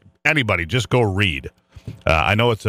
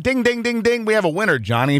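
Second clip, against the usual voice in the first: lightly voiced game show host, higher; 11 semitones higher than usual. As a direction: announce the winner like a quiz host. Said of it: "Behind door number three".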